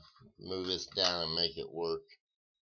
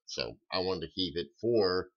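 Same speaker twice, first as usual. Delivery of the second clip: ordinary delivery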